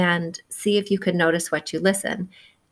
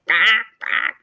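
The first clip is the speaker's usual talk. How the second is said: big ducks quacking; 3 semitones higher, 4 dB louder than usual. Acted. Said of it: "The big ducks say".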